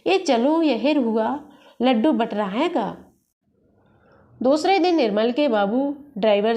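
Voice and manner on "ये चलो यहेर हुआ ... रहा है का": ordinary delivery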